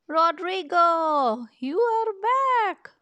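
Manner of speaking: put-on high, loud, slow old lady's cry; thrilled old lady greeting her lost pet; old lady's delight. Old lady greets the moose she thinks is hers, high and thrilled.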